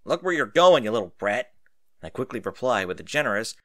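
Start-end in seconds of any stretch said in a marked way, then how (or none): 0.0-1.5 sharp and much louder; angry snap. A narrator's reading. Stranger snapping at the kid who bumped him, angry and much louder. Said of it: "he promptly snaps at me".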